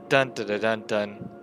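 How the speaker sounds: ordinary talk, nothing marked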